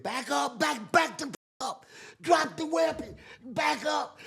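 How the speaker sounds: high, shouted orders; guard commands. Retold or imitated. Guards yelling at the inmate to drop the weapon, high. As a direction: bark orders at an armed inmate.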